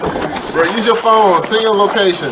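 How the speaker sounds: loud, high advice to the screen; exasperated yell. Real, not acted. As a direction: shout it at the screen.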